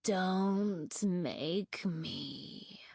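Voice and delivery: very slow, creaky whisper; eerie rasping whisper. A narrator's reading. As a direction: breathe it out like claws scraping on ice. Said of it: "creaky and quiet and rough and eerie".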